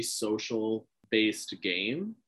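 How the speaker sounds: slow, drawn-out words; slow emphatic. Real, not acted. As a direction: stretch each word out.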